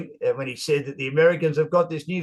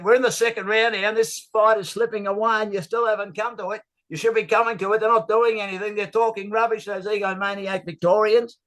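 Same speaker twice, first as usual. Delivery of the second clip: higher, lightly voiced huffy old commentator; George's indignant rant; 6 semitones higher than usual. Annoyed commentator griping he's been ignored, lightly voiced high huff. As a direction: grumble on like a snubbed blowhard, pitched up. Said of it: "George has got a voice like Foghorn Leghorn".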